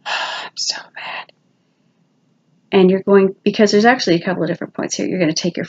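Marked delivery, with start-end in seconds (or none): 0.0-1.3 slow whispered so mad after a sigh; sigh, then whisper it slowly. Shown as a demonstration. Teacher demoing the reminder phrase, sighing whisper.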